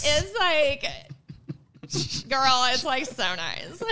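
put-on loud, high California girl gush; hammed-up California valley girl. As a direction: play it big as a California girl. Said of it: "It's the California coming out of me".

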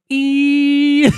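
very high mock ringing ee; high squeak. Shooter imitates the ringing ear after the shot, high squeak. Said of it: "all you heard was".

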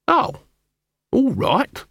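high squeaky Nobby, reluctant; squeaky giving in. A narrator's reading. Nobby giving in to Cuddy, high and nervous.

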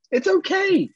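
extremely high, loud fake reassurance; gritted-teeth cheerfulness. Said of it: "I'm, like, gritting my teeth".

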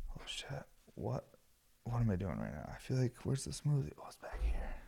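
hushed, half-whispered mutter; muttering to himself. Real, not acted. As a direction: mumble it under your breath, searching for something.

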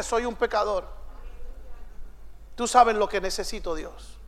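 soft, hushed, humble plea; a sinner's soft, low murmur to God; humble sinner's prayer. Acted. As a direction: pray it quietly, head bowed. Humble sinner praying quietly.

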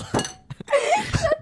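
squealing high laugh. Real, not acted. Laughing at vol.

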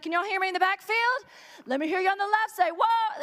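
a touch of a hype preacher working the crowd; mock hype preacher. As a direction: lightly call out to the backfield. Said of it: "tested the mic and he's like".

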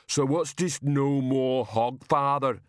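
big simple man demanding an answer; slow-witted giant. A narrator's reading.

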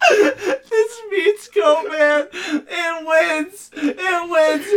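high, strained, tearful laughing; crying with laughter. Real, not acted. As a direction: surrender through tears of laughter.

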